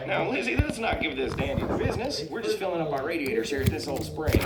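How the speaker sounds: put-on high-pitched old-time car voice; Stanley. Old car soothes his companion, high character voice. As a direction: placate in a high cartoon voice. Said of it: "Stanley looks up and he's all".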